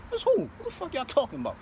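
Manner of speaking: high confused feigned ignorance; playing dumb. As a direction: act like you have no clue. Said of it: "I'm like".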